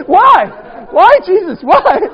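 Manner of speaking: loud, high why to Jesus; comic lament. Real, not acted. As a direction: cry out to heaven in mock despair.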